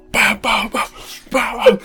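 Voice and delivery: imitating heavy running steps; pounding footsteps.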